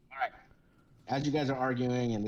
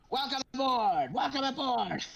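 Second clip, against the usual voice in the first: kobold captain; 13 semitones higher than usual. Acted. Kobold captain welcoming the party aboard, high and hammy. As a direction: boom a grand welcome as a tiny captain.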